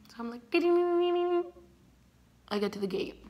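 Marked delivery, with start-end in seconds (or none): 0.5-1.4 high, rapid ringing noise; quick run of high-pitched dings; imitated dinging. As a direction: make a high little running sound effect. Imitates a noise while racing off.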